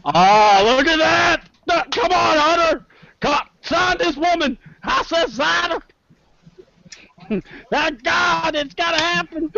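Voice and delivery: high, hyped-up outburst; excited hollering. Acted. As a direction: holler it high, like pitching a star signing. Hyped reaction to her manager pitch.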